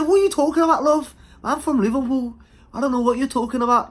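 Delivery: put-on high Liverpool accent, quick and defensive; Scouser accent. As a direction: put on a Scouse girl denying it. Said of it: "I know that's a Scouser accent".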